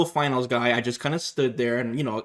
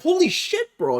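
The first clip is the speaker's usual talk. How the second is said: much higher, stunned Holy shit; shocked exclamation. Retold or imitated. Cornered player reacting to a beatdown, shocked. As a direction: blurt it out in disbelief.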